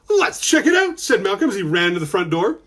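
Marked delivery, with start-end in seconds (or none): 0.1-1.0 high-pitched eager call; eager boy. Acted. Malcolm urging his brother to investigate, high and eager. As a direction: pitch it high like a keen kid.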